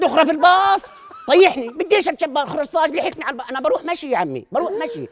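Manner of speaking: high, loud panic; panicked yelling. Acted. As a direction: yell to get off the bus. Panicked yelling at bus driver.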